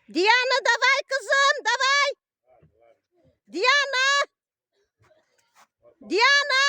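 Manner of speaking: very high, loud cheering. Real, not acted. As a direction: yell encouragement at a racer. Mother cheering daughter in ski race.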